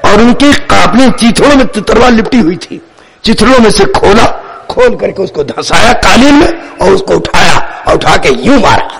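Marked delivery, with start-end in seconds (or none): none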